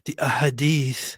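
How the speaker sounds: Ahadith whispered with an Arabic-style h; a hushed, teasing Arabic pronunciation; whispered mock Arabic. Host mocking the guest's Arabic terms, whispering in mock Arabic. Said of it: "I could say Ahadith".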